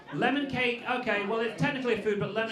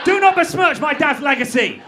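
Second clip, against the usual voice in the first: hammed-up indignant outburst, louder and rising; mock offended daughter; 5 semitones higher, 10 dB louder than usual. As Walt Disney's daughter scolding the crowd, mock outraged. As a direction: play the outraged heir defending her father. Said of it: "daughter of Walt Disney".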